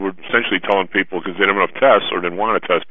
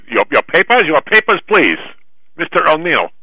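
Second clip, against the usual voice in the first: hammed-up German officer accent, pitched higher; mock German accent; 8 semitones higher than usual. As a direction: bark the order in a put-on German accent. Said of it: "it's a pretty good German accent".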